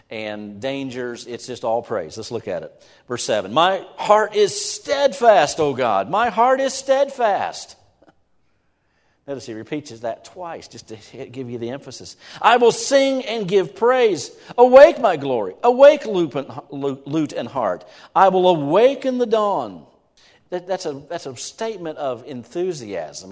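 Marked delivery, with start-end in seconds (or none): 3.5-7.8 high, loud, slow proclaiming of the psalm; ringing psalm reading; preaching at full force. Acted. 12.4-19.9 ringing psalm reading; preaching at full force. Acted.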